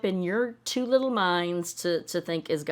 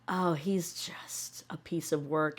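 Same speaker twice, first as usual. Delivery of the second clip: slow whispered aside; 3 semitones lower, 6 dB quieter, slower than usual. Real, not acted. Whispered aside about Cody.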